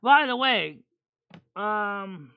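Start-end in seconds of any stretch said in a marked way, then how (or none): none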